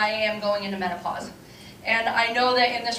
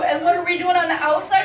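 ordinary talk, nothing marked

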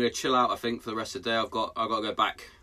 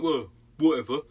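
dismissive daughter; 4 semitones higher, 4 dB louder than usual. Retold or imitated. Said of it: "Then she's like".

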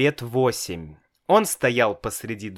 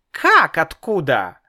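offended; 5 semitones higher, 5 dB louder than usual. A narrator's reading. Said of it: "обиделся Славик".

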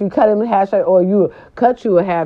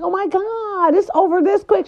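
retold disbelief, pitched way up; acted amazement; 13 semitones higher than usual. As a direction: marvel in a high-pitched voice. Acts out surprise at quick exam.

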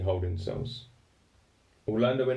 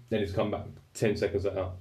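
normal talking voice

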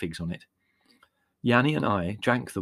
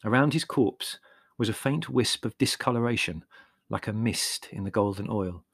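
usual speaking voice

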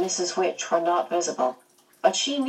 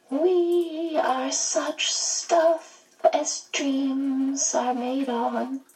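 high, very slow, drawn-out recitation; smiling quotation; 9 semitones higher, much slower than usual. A narrator's reading. Missus Who quoting as she appears, high, slow and smiling. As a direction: recite it slowly with a broad smile. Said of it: "she smiled broadly".

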